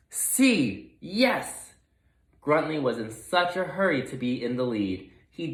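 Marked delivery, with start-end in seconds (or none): 0.1-1.7 high, excited yelp; eager pig. Acted. Gruntley rushes off after half a clue, eager. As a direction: jump at the half-heard clue.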